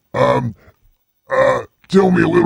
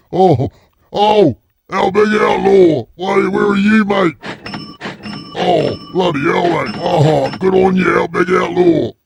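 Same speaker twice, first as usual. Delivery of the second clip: excited shout; 7 semitones higher, 3 dB louder than usual. Acted. Greeting viewer excitedly.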